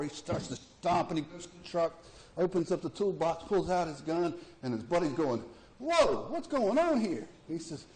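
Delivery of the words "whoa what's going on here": startled. Acted. Buddy startled in joke.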